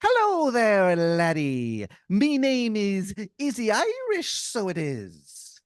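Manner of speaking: high, loud, slow stage-Irish brogue. Acted. Leprechaun-like stranger greeting the player, hammy Irish brogue. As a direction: ham up a leprechaun-style Irish barfly. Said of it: "And he goes".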